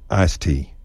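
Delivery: "iced tea" as "ice tea", slow; ice tea without d. Shown as a demonstration. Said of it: "ditch the D on iced tea".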